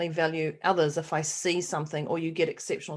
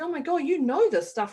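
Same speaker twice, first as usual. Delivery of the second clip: higher-pitched, impressed exclamation; retold amazement; 7 semitones higher than usual. Customer to the lady testing her eyes, retold amazement. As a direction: re-enact being wowed, voice lifted.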